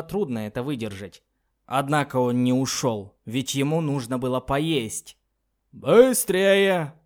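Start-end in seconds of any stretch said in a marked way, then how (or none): 5.7-6.9 rasping, loud and high bark; hoarse shout. Acted. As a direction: snap it out in a rough, hoarse voice. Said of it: "раздался хриплый голос".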